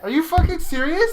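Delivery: high, loud, incredulous; indignant cry, rising on fucking; outraged disbelief. Real, not acted. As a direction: protest the verdict in shock. Outraged at losing the vote.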